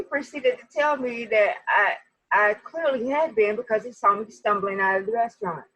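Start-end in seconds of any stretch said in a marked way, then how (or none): none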